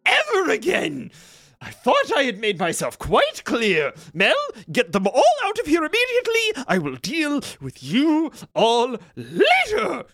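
the keeper loses patience with trespassers; angry wizard Ganbald. Acted. GM voices Ganbald catching intruders.

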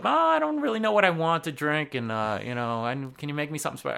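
high-pitched girl customer, imitated; mock girl ordering at the bar. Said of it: "girl comes up".

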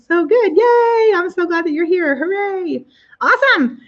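high cheerful cheering. Real, not acted. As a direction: cheer along like a fan. Celebrating the prize.

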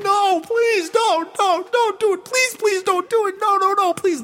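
very high, panicky mock begging; hammed-up plea for her life; mock frantic pleading. Janet begging them not to press the button, high panicked pleading. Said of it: "She's like".

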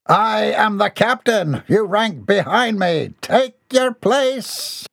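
high, slow, imperious; pompous command. A narrator's reading. Captain pulls rank on his mother, high pompous command. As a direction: draw out each order grandly.

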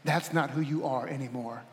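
low, soft reassurance; Spirit's gentle prompting. Acted. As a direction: quietly affirm it, as a tender inner voice. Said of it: "phrases that I get every now and then in prayer from the Spirit of God".

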